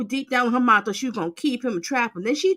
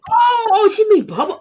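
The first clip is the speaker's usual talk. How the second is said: laughing outburst; 10 semitones higher, 9 dB louder than usual. Real, not acted. Host bursting out at joke.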